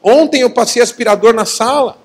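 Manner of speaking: high-pitched indignant defence; retold yell back. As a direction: protest in a mock yell. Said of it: "Gritei de volta".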